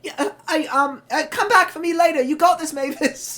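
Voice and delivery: cheerful despite the crash; flustered Percy. Acted. Derailed Percy calling after Mavis, high and flustered. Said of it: "And he's like".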